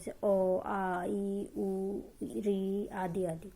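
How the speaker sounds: each vowel drawn out slowly and held; slow, careful vowel demonstration; vowel sounds demo. Shown as a demonstration. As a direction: sound out अ, आ, ई, उ one at a time like a teacher. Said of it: "उसे स्वर कहते हैं".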